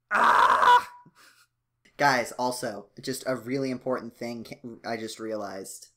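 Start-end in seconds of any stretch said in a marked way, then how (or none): 0.1-0.9 sudden high, loud mock scream; Phoenix. Lawyer yelling at fingerprints in a somber investigation, mock scream. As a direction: scream at the evidence in mock earnest. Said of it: "picturing Phoenix yelling at fingerprints".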